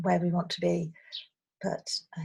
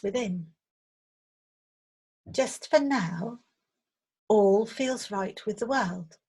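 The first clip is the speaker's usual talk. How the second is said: guided meditation; 3 dB louder than usual. Acted. Meditation teacher leading listeners on an imagined riverside walk, calm guided visualization. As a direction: guide listeners gently, phrase by phrase.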